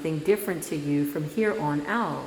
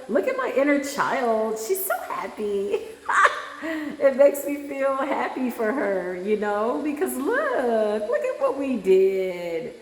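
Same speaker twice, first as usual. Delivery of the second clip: delighted gush; 5 semitones higher than usual. Real, not acted. Host delighted at the happy inner child on screen, giddy and high.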